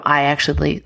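normal talking voice

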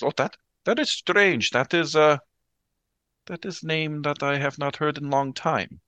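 put-on an old nun rattled by a name from her past; Sister B shaken.

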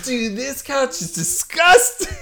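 very high, drawn-out revulsion; exaggerated disgust. Reacting to Clark's much-used couch, hammed-up disgust. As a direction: squeal it slowly in mock horror.

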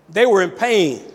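ordinary talk, nothing marked

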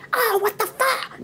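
high, drawn-out mock exasperation; mock angry outburst. Boyfriend cursing the third wave, high mock anger. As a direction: stretch out an exasperated cry. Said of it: "se enoja".

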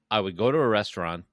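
usual speaking voice

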